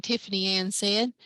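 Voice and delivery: ordinary delivery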